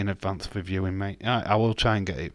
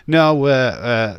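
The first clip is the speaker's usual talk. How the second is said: loud flustered denial; 5 semitones higher, 9 dB louder, slower than usual. Real, not acted. Quick denial to chat.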